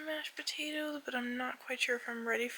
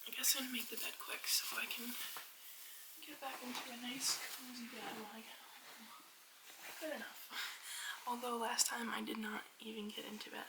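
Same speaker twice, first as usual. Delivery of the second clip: soft unvoiced whisper; 9 dB quieter than usual. Real, not acted. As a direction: whisper it quietly.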